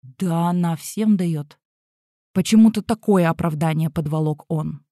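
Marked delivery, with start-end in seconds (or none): 0.0-1.6 slow, awkward drawn-out excuse; squeezed out reluctantly. A narrator's reading. Pupil making a feeble excuse to his teacher, squeezed out reluctantly. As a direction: squeeze it out slowly, uneasily. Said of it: "выдавил из себя".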